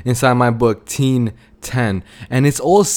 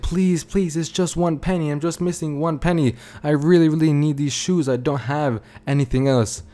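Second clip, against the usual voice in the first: higher-pitched, mock-desperate begging; pleading customer; 3 semitones higher than usual. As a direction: act out a woman pleading over one penny. Desperate customer begging in a retold story.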